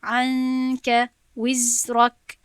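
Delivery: عنک وزرک recited very slowly, ن and ز held in measure; medium letter timing. Shown as a demonstration. Teacher showing students medium timing, slow measured recitation. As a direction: stretch the ن of عنک, then the ز of وزرک. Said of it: "در اینجا وقتی متوسط دادم".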